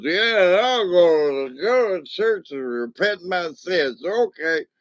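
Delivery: a hammed-up drunk, high-pitched; drunk man voice. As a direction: play the groggy drunk who'll say anything. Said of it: "And he goes".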